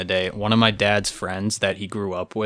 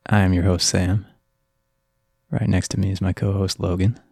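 breathy, close-mic radio host; creepy late-night host intro. Acted. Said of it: "the close-up late-night D J voice on the mic".

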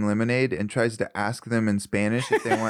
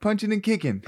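mock ad pitch; 8 semitones higher than usual. Mock ad for the gym.

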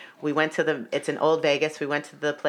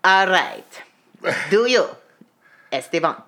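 halting, lightly imitated Russian accent. Elvis officiant reading the vows, halting mock Russian accent. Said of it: "with his Russian-ass accent".

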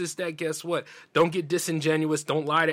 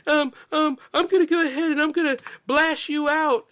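much higher, whiny mock voice of an angry woman posting online; hammed-up impression of a woman warning her followers; mocking girl voice; 10 semitones higher, 3 dB louder than usual. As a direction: parody her rant in a high, petty tone. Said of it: "her little page saying".

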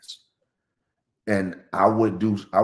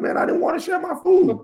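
mock whine. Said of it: "It's like".

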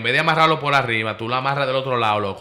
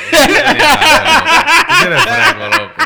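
high, loud laughing through words; laughing hard; 10 dB louder, much slower than usual. Real, not acted. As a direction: crack up while teasing. Laughing hard at friend's story.

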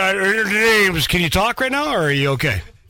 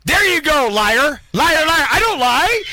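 higher and louder, calling him a liar; angry accusing; 5 semitones higher, 4 dB louder, slower than usual. Real, not acted. Brother accusing the host of lying, loud and angry. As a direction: hurl the word liar at him.

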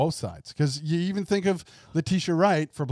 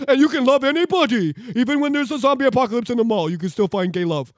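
much higher, louder, sing-song sarcastic parody; sarcastic mock voice; 9 semitones higher, 8 dB louder than usual. Co-host parodying woke writers, sarcastic sing-song voice. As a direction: ham up the preachy inclusive line. Co-host mocks identity politics.

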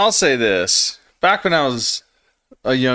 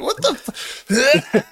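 retold panic; much slower than usual. Retold panic at being caught.

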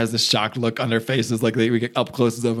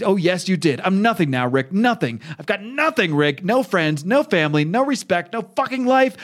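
high-pitched, emphatic angry rant; acted bitter outburst; 6 semitones higher than usual. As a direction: rant with rising desperation, punching each loss. Acted despairing outburst at former friend.

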